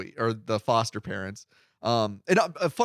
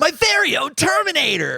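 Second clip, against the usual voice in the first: gleeful mock shout; 13 semitones higher, 5 dB louder than usual. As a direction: shout it like a kid showing off a new toy. Excited mock exclamation of delight.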